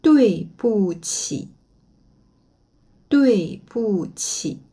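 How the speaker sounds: slow dui bu qi, each syllable separate; mandarin pronunciation. Shown as a demonstration. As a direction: model dui bu qi slowly for learners. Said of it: "Désolé". (dui bu qi lentement, syllabe par syllabe)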